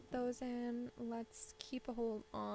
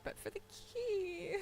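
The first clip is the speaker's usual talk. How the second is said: laughing through it; 8 semitones higher, much slower than usual. Real, not acted. Resigned joke over lost money.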